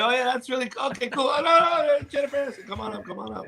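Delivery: high-pitched, hurried brush-off turning to a star; distracted fake enthusiasm. Interviewer ditching a guest for Jennifer Aniston, falsely enthused. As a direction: feign interest, then flag someone over.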